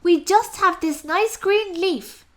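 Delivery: small and timid; tiny ants. Acted. Ants answer.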